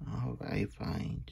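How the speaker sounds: grudging whisper; whispered reluctance. Real, not acted. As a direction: give in under your breath.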